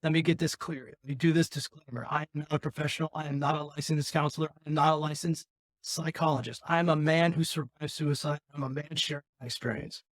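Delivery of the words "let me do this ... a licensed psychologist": ordinary talk, nothing marked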